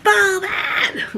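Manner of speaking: high, harsh mock shout; rude lady's yell. Older lady ordering her off the deck, harsh high yell. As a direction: snap the order like a cranky old lady. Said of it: "She was like".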